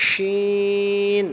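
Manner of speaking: শিন with a long hushing sh from the middle of the tongue; thick sh sound. Shown as a demonstration. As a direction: push the air out through the middle of the tongue on শিন. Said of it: "এই দেখেন".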